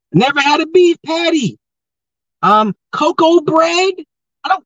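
high, put-on mock voice; mock clueless outsider.

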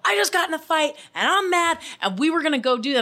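high-pitched mock tirade; retold angry outburst. Retelling her outburst to the co-host, worked up and mad. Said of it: "I screamed and I told Steven".

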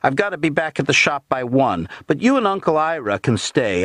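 high-pitched chatty woman's voice; Wilma. A narrator's reading. Niece excusing herself to relatives, high-pitched and chatty. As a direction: keep it high and everyday. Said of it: "said Wilma".

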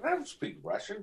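high, soft dismayed protest; mock protest. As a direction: plead it up high, pulling back in volume. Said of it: "I said".

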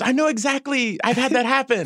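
very high, eager child impression; excited girl. Young actress recalls a dog bite to her director, excited. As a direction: imitate a kid thrilled to relate. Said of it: "she got so excited".

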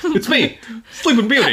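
high, put-on princess voice; Sleeping Beauty. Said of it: "She talks like that".

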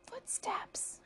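high, hushed stage whisper; soft, suspenseful whisper. A narrator's reading. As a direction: whisper it as a spooked warning. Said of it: "whispered Buster".

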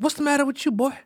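high-pitched mock grandma; grandmother. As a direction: imitate an old grandmother questioning her grandson. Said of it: "and my grandmother".

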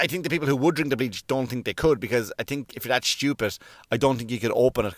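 usual speaking voice

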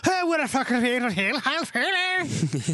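mock Irish brogue, barely understandable; thick Irish mumble. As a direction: mash the words into a thick brogue. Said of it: "They sound like".

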